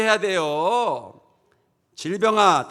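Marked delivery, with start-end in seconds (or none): 2.0-2.7 loud, raised address; commanding shout. Shown as a demonstration. Preacher addresses sickness for congregation to repeat, commanding shout. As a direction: call it out as a stern command.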